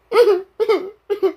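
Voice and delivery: high cartoon-dog whimpers; mock dog crying. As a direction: sob in short, fake squeaks as a hurt dog. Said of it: "are you crying, Scooby?".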